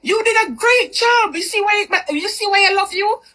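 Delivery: mock gushing boyfriend, pitched high. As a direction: pitch it way up and gush. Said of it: "he goes".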